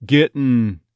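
"Getting" said as "GIT-n"; "Getting" with "git" vowel and no G at the end. Shown as a demonstration. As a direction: raise the E in "Getting" to a short I. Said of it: "go towards get instead of get".

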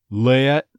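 "Let" as "LEH-uht"; loud "Let" sliding into a schwa; stressed off-glide. Shown as a demonstration. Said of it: "an off glide".

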